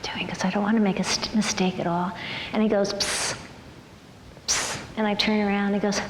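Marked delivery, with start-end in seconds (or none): 2.9-4.8 sharp whispered "psst", done twice; secretive attention-getting psst; imitated psst. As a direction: hiss a furtive psst for attention. Baryshnikov secretly calls her in class.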